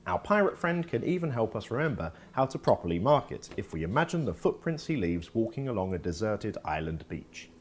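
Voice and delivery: ordinary talk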